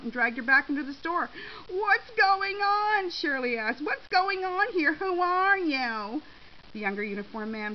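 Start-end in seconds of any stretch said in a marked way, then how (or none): 1.7-3.1 high, loud, drawn-out outcry; alarmed demand. Acted. Seized by store security, demands answers. 3.8-6.2 high, loud, slow indignant outcry; alarmed demand. Acted. Seized by store security, demands answers.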